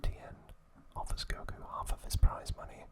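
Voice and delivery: ordinary delivery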